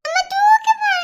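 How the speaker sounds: high, tearful plea; crying child. Acted. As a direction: plead tearfully with mother. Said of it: "எதுக்கு அழுகுற?".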